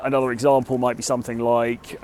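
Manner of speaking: ordinary talk, nothing marked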